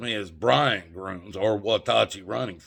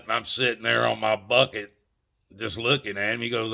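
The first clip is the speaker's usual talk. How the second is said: normal talking voice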